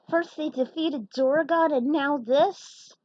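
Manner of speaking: terrified heroes about to ram the satellite; panicked heroes. Acted. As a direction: panic as the ship is about to crash. Heroes panic before crash.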